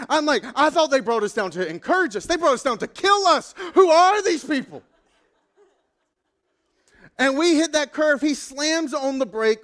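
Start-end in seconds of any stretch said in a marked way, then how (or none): 0.5-4.8 rising, higher and louder panicked rant; mock panic. Pastor in the speeding Porsche thinking he'll die, mock panic rising loud. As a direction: climb into hammed-up terror. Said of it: "I'm, like, freaking out on the inside".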